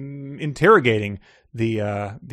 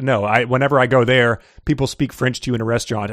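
usual speaking voice

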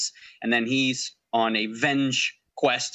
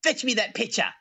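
bossy princess voice; 8 semitones higher than usual. Retold or imitated. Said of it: "she's like".

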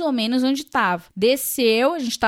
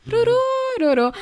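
calm translator imitation; 12 semitones higher, faster than usual. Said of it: "assim, super calmo".

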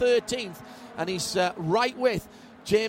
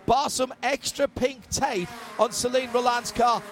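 raised, lively live race call; motorsport commentator; 4 semitones higher than usual. Acted. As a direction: call the overtakes as they happen.

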